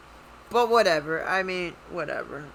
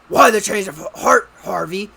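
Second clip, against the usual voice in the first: Batman voice; 10 dB louder than usual. Retold or imitated. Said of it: "changes his whole demeanor of his voice".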